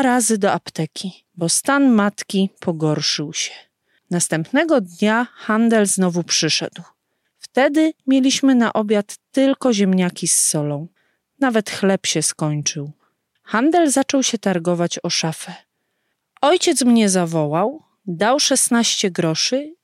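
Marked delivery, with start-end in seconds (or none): none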